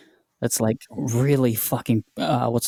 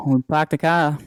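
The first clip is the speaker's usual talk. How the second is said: thick New England accent, higher; 4 semitones higher than usual. Shown as a demonstration. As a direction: ham up the New England dropped R's. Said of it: "dropping our R's up here".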